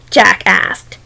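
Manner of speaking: usual speaking voice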